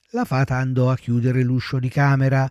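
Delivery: plain reading voice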